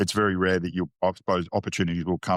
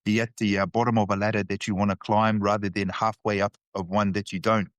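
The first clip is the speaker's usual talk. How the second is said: put-on quoting a Kiwi uncle, barely putting on his accent; a faint Kiwi twang, not really committing to it; half-hearted Kiwi accent; 3 semitones higher than usual. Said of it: "I'm not even gonna try and do the Kiwi accent".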